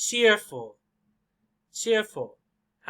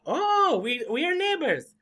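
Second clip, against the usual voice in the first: very high, eager, delighted chatter; outgoing person; 10 semitones higher than usual. Acted. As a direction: gush it out to a new acquaintance.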